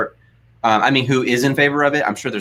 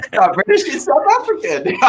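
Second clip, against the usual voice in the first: laughing; 12 semitones higher than usual. Real, not acted. Laughing about his own accent.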